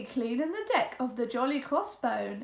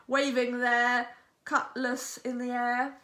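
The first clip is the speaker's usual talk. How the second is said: usual speaking voice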